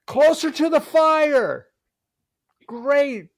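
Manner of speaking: high, drawn-out mock shouting; calling out. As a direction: imitate a director hollering from safe cover. Acts crew calling directions from afar.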